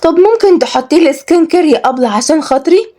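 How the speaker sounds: high, eager little wheedle; pleading girl. Acted. Girl asking Kenzi for some skincare, pleading.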